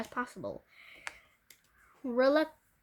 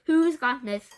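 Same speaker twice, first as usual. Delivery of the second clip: demanding; 3 semitones higher, 10 dB louder than usual. Acted. Teacher demanding of class.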